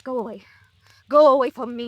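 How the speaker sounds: pleading then loud push away. Acted. Distraught sister orders the detective to leave, rising to a cry. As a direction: drive him off, voice rising.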